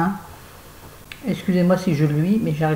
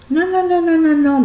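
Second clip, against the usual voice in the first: much higher, louder run of "non"; a sudden high, loud burst of "non, non, non"; shrill mock denial; 13 semitones higher, 5 dB louder than usual. Real, not acted. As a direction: squeal out a string of playful nos.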